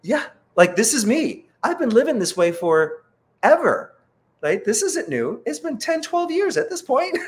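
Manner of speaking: high-pitched, emphatic matter-of-course protest; incredulous self-defence. Retold or imitated. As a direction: insist it's old news, pitch climbing. Acts out reply to startled interviewer.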